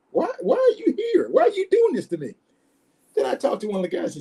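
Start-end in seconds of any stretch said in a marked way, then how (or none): 0.1-2.3 high-pitched, pleading self-questioning; wounded "why me" in a high voice; exasperated inner protest. Retold or imitated. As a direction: reenact bewildered frustration, pitch way up. Recalls exasperated thought at mistreatment.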